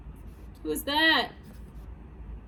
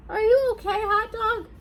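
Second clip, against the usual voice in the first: high, raised-voice concern; worried calling out; 6 semitones higher than usual. Acted. Reader checks on the fallen hot dog, high anxious call. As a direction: call out high to someone who fell.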